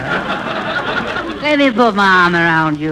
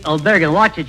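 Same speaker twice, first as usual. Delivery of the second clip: usual speaking voice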